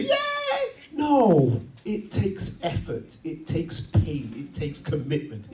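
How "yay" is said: very high-pitched squealed cheer; mock joyful. Preacher mocking athletes getting up joyfully, high fake cheer. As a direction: squeal it in exaggerated glee. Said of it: "joyfully say".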